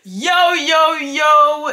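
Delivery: rapper-style hype intro; bouncy hip-hop hosting flow; Balou style intro. Pretend. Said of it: "en style Balou".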